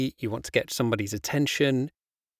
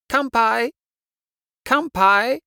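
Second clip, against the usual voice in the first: loud, high festive "Kanpai!"; cheering toast; 11 semitones higher, 7 dB louder than usual. Shown as a demonstration.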